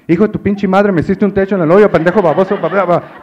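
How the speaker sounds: high, very loud Spanish tirade; mother's furious scolding. Retold or imitated. Mother answers her son's phone call, yelling abuse. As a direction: rant like an outraged mom.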